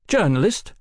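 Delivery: high, suspicious questioning; suspicious demand. A narrator's reading. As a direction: demand an answer, full of suspicion. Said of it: "he demanded".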